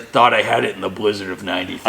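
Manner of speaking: a hint of a salty old tow driver; low, lightly voiced old-timer; gruff old fisherman. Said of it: "the old salty tow truck driver".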